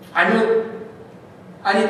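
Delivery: ordinary delivery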